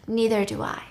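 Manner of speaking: soft, near-whispered reply; hushed admission. Retold or imitated. Acting her tender reply to him, hushed. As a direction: let it out softly, almost a whisper.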